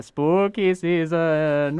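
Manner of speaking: spooky voice. Pretend. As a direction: stretch it out like a ghost-story host. Spooky sign-off right after cackle.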